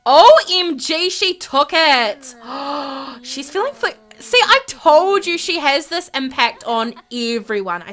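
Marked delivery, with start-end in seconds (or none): 0.1-5.4 thrilled shout, drawn out slowly; real delight, loud and stretched; excited. Real, not acted. Her game move just succeeded.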